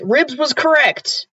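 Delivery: ordinary talk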